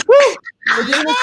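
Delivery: high excited cry; excited shout. Real, not acted. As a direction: shout it out thrilled. Excited cheer after song.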